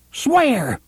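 high shrill cry of Swear. Acted. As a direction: shriek the one word, high. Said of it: "Cordelia cried shrilly".

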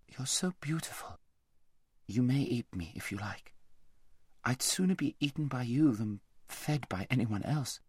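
soft, timid, awed voice; trembling mare. A narrator's reading. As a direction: quietly offer yourself in awe. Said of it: "shaking all over".

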